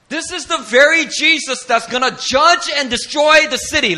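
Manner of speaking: loud, high, driving sermon voice; preaching at full force. Acted.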